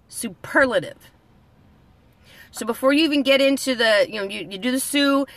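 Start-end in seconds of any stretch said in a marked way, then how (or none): 0.1-1.1 superlative, PER syllable stressed. Shown as a demonstration. Teaching viewers the word, stress on PER. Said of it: "The emphasis is on the P E R L".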